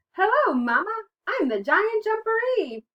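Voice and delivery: very high, loud little-one voice; high-pitched, gleeful storybook child voice; baby frog. A narrator's reading. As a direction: pipe up proudly as a baby frog. Baby Frog revealed, greets Mama.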